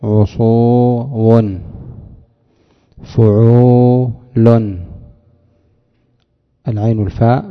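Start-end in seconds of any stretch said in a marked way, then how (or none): 0.0-4.8 each syllable stretched, slow; slow demo. Shown as a demonstration. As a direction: spell it out slowly like a teacher. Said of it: "على فعول تقول عصو".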